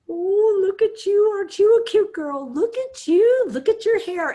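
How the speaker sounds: high, loud cooing auntie voice; gushing aunt. Shown as a demonstration. Doting aunt cooing over a little girl's looks, high and loud. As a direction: coo at a little girl you pinch. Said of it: "Those are those aunts".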